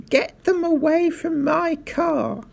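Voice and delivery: very high-pitched outraged cry; shouting. A narrator's reading. Mister Hazel frantic about his Rolls, shouting. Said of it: "shouted Mister Hazel".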